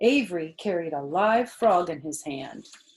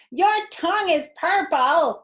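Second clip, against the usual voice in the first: much higher, louder shriek; screamed; 11 semitones higher, 8 dB louder than usual. A narrator's reading. Fern teasing Avery, shrieking high and loud. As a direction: squeal it across the barn. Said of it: "screamed Fern".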